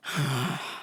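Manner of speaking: low, soft exhale of defeat; defeated sigh. Retold or imitated. Man defeated by the broken pump, heavy sigh. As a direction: let the rage drain out in a sigh.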